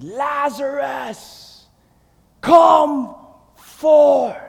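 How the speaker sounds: slow, drawn-out call to the tomb; commanding shout. Acted. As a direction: call him out of the grave, stretching the name. Jesus calling dead from tomb.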